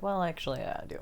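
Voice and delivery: soft, dry comeback; quiet deadpan. Retold or imitated. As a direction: drop it quietly and flatly. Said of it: "It's like".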